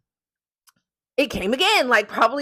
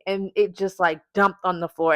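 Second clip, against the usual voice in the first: ordinary talk, nothing marked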